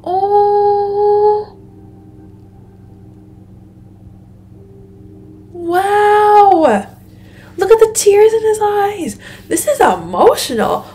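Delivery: high, drawn-out awe; moved amazement. Real, not acted. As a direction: coo high, deeply touched by the scene. Moved by tearful farewell.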